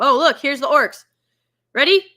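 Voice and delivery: usual speaking voice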